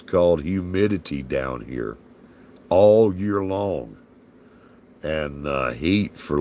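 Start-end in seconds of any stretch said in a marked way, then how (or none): 2.7-4.0 drawn out. Real, not acted. Stretched out for emphasis.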